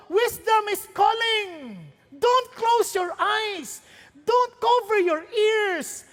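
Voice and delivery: slow, drawn-out preaching call. Acted. Preacher urging the congregation, slow and drawn out. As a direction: plead with the congregation to listen.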